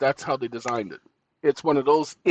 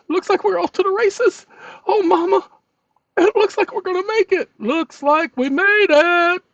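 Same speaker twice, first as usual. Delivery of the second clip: much higher hammed-up race commentator; mock race announcer; 6 dB louder than usual. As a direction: call it like a horse race.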